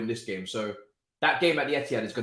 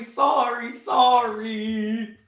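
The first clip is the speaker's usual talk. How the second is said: mock apology; 10 semitones higher, 6 dB louder, much slower than usual. Mock apology after team concedes.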